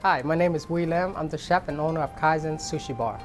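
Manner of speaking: normal talking voice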